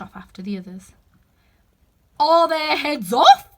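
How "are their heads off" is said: slow, loud, high royal shout; Queen shouting. Acted. The Queen shouting to her soldiers, slow and loud. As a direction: demand an answer across the garden. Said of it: "shouted the Queen".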